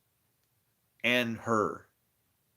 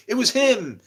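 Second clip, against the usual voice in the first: high, loud accusation; accusing shout; 12 semitones higher, 8 dB louder than usual. Retold or imitated. People accusing him, shouting it was him. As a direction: point the finger and yell it. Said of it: "people are saying".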